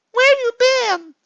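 put-on high, whiny, needy girlfriend voice; clingy girlfriend. Girlfriend reading her needy messages aloud in a high whine. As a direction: plead like a lonely girlfriend in a high voice.

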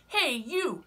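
high, loud, grumpy bug; grouchy ladybug voice. Acted. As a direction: bark the challenge up high. Ladybug challenges skunk.

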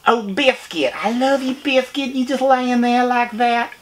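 high southern voice doting on a dog; baby-talk to the pet in a high southern accent. Pretend. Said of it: "Talk to me like I'm your dog".

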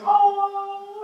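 very high, held "oh"; wife's reaction. Retold or imitated. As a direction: imitate a wife's high-pitched coo. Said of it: "my wife walks in and goes".

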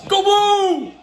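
high, loud rallying shout; team cheer. Real, not acted. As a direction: whoop it out like a sports fan. Fan cheer for Michigan game.

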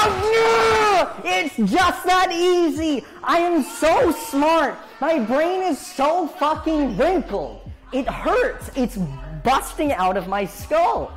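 loud, high gloating yell; triumphant shouting. Real, not acted. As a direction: shout like you just won. Triumphant gloating over the fix.

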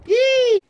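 high-pitched gleeful yelp; excited yee. Pretend. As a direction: squeal with delight. Cannon shooting bottles, play cheer.

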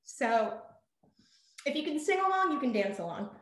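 ordinary talk, nothing marked

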